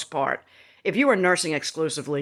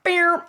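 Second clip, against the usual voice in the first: beer sprung much higher and louder like a snapping rubber band; cartoon boing sound; 12 semitones higher, 4 dB louder than usual. Shown as a demonstration. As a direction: pop out beer like a cartoon spring. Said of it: "her body just went".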